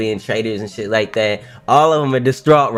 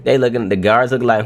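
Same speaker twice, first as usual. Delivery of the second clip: normal talking voice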